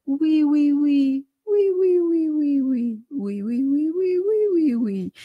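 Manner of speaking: shy, whimpering oui sliding up and down; timid whining yes. Shown as a demonstration. Coach demonstrating a timid child's oui. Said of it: "soit sur la syllabe oui".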